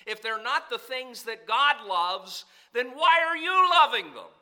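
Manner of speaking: rising to a loud, high challenge; preaching burst. Acted. Preacher challenging his listeners, in a rising preaching cry. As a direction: build into a sermon cry.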